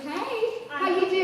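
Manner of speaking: a light Southern drawl on the greeting; Southern accent. Retold or imitated. Hinting at a Southern accent she used on the audiobook. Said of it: "I did a Southern accent".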